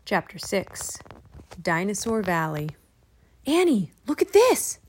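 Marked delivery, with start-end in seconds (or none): 3.5-4.8 high, excited call. A narrator's reading. Jack calls Annie to see his find, calling out. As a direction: call her over to see. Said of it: "Jack called".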